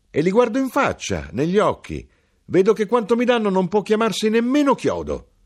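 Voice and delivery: very high, loud, mocking crier; a birdlike street crier scorning people; high, loud scornful hawker; knife-grinder.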